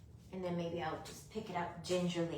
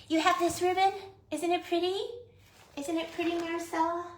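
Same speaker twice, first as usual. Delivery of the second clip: cooing; 10 semitones higher, 4 dB louder than usual. Real, not acted. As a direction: coo like talking to a pet. Cooing to Marcella about ribbon.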